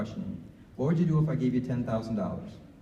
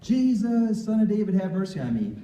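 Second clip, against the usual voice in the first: high, loud cry that fades toward the end; desperate shout; 7 semitones higher, 4 dB louder than usual. Retold or imitated. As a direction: shout to catch Jesus' attention from afar. Blind man crying out to Jesus.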